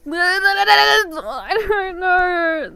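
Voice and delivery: flustered, dragged-out whimper; helpless whine. Real, not acted. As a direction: wail it weakly, stretching the words. Flustered, drawn-out moan of helplessness.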